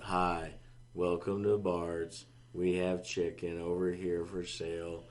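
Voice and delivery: slow, dull intercom announcement; bored monotone. Shown as a demonstration. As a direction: demonstrate a lifeless store announcement. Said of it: "it's not gonna be that monotone voice".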